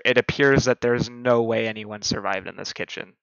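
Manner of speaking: normal talking voice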